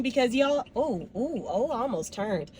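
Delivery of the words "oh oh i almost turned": usual speaking voice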